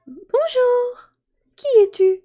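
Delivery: very high, whispered then voiced fairy voice; a little fairy greeting a boy; tiny fairy. Fairy greets Jacques at the hearth, tiny high voice. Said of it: "voir une fée".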